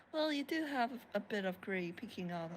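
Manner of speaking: straight-faced, dry teasing; deadpan tease. Acted. Host teasing Tome about gray hair, deadpan. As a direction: say it dead straight, like a fact. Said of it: "completely straight-faced".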